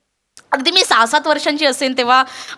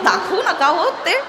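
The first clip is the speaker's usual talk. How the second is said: ordinary talk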